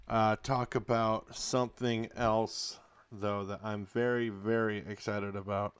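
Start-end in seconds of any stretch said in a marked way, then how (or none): none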